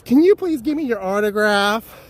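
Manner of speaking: high, eager autograph-seeker voice; pleading fan. Retold or imitated. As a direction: beg sweetly like a starstruck fan. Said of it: "she grabs him by his hand and says".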